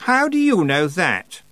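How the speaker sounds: a touch of a sharp retort; snapped. Acted. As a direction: demand an answer, irritated. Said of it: "Anagramma snapped".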